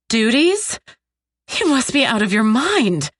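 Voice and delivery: high, incredulous retort; outraged disbelief. A narrator's reading. Captive told of duties by her captor, outraged disbelief. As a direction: fling it back, appalled.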